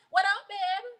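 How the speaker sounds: ordinary delivery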